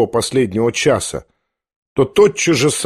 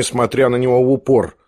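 ordinary delivery

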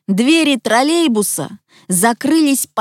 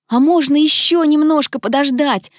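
put-on higher-pitched boyish plea; pleading request; 7 semitones higher than usual. A narrator's reading. Petya asks Tik-Tak to wait, pleading. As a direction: ask hopefully for a little delay. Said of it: "попросил Петя".

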